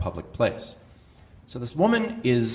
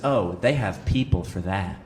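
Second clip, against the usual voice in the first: snooty entitled; 7 semitones lower than usual. Retold or imitated. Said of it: "And she says".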